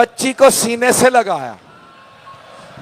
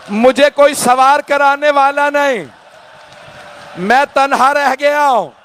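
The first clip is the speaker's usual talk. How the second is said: slow, longing lament, higher; बड़ी हसरत से; 4 semitones higher, slower than usual. Acted. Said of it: "फिर बड़ी हसरत से कहा". (बड़ी हसरत से, धीमे और ऊँचे सुर में)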